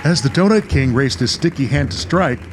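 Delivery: ordinary talk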